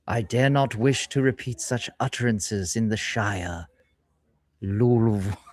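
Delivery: posh-leaning mock British accent, overly proper; fake British accent. Shown as a demonstration. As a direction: put on a hammy British accent for the joke. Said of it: "Faking a British accent".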